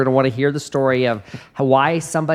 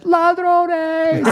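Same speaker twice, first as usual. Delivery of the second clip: high startled scream of ladrones; screamed Spanish; 3 dB louder than usual. Retold or imitated. Missionary screaming at a burglar in the dark, high panicked yell. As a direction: shriek it like seeing robbers. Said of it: "screamed in Spanish".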